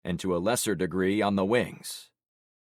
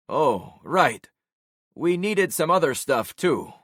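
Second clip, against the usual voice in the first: higher and louder, covering quickly; Alvaro's voice; 8 semitones higher, 5 dB louder than usual. Acted. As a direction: scramble for an excuse.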